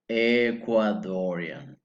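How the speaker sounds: "Ecuadorian" drawn out slowly; slow model word. Shown as a demonstration. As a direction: stretch out "Ecuadorian" for learners to copy. Teacher repeats word for practice.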